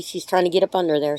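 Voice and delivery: ordinary talk, nothing marked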